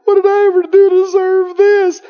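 hammed-up high voice of self-pity; mock complainer. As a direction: imitate someone wailing about unfair treatment. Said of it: "hear people sometimes say".

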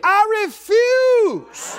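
high shout, long drawn out; defiant declaration. Shown as a demonstration. Preacher leading congregation in refusing fear, drawn-out defiant shout. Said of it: "You say".

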